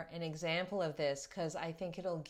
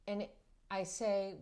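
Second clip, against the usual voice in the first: usual speaking voice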